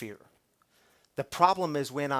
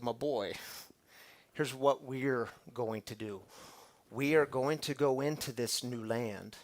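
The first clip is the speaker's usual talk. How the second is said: soft, low, fatherly confidence; God gently reassures Joshua; tender God voice; 5 semitones lower, 7 dB quieter than usual. Acted. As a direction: speak gently like a loving father.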